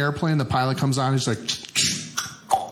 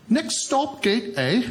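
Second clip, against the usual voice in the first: British announcer; 6 semitones higher, much slower than usual. Retold or imitated. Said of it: "with a British accent".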